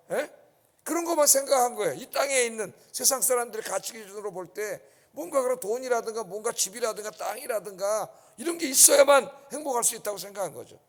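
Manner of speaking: ordinary talk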